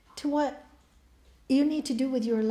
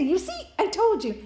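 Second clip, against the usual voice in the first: smug gloat; 6 semitones higher, 5 dB louder than usual. Acted. Priest gloats at funeral.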